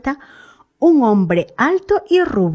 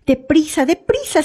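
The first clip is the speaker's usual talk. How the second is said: higher-pitched hurried urging; urgent self-talk; 8 semitones higher than usual. A narrator's reading. Woman telling herself to hurry as she tidies up, urgent. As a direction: urge yourself on in a higher voice. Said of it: "se dijo a sí misma".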